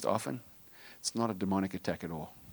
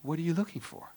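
higher, softer patient mother; sweet mother; 7 semitones higher, 3 dB quieter than usual. Retold or imitated. Mother in Kmart asking her little son, softly patient. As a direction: gently repeat the question like a kind mom. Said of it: "She says".

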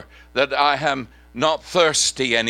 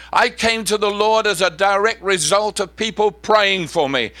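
loud, high, driving sermon delivery over music; preaching at full force; 8 semitones higher, 4 dB louder than usual. Acted. Preacher rousing the church to pray and act, full force. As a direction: thunder it out like a revival preacher.

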